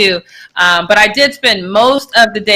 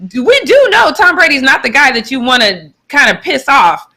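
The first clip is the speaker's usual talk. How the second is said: usual speaking voice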